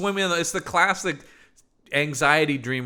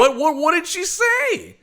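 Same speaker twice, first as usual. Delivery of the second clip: high, loud, stammered disbelief; incredulous outburst; 14 semitones higher, 6 dB louder than usual. Acted. Reacting to Elizabeth freeing Victor, loudly incredulous. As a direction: blurt the question in mock astonishment.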